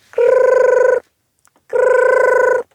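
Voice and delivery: high, steady trilled ringing; vocal ringtone on one high note; imitated phone ring. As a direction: trill like a telephone, two rings. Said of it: "Plötzlich klingelte es".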